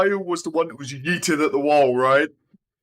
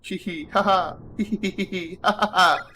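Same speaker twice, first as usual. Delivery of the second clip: higher, playful hee-hee ha-ha; creepy giggle; 7 semitones higher, 4 dB quieter than usual. Acted. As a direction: giggle like a creepy ghost child, hammed-up.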